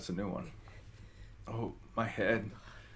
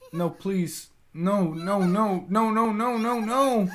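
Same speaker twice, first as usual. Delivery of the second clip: rising, higher and louder "no, no", ever more frantic; panicked pleading; 10 semitones higher, 8 dB louder, slower than usual. Acted. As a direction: beg in growing panic. Panicked denial in horror game.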